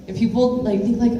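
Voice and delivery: ordinary talk, nothing marked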